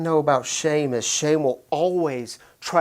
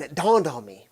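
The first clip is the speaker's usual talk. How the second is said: ordinary talk, nothing marked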